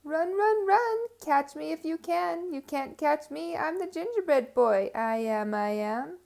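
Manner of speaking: high, loud teasing chant; taunting call. Acted. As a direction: taunt them, sing-song and cocky. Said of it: "laughed and called out".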